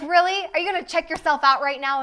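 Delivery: high, loud teasing incredulity; mocking disbelief. Wife teasing husband mid-argument, mocking disbelief. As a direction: tease him for checking the mirror. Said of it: "I was like".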